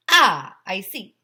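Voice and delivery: slow, loud British "ah"; a knowing British official nodding along; British accent. Acted. Guinness lady taking it in, slow British accent. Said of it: "the Guinness lady said".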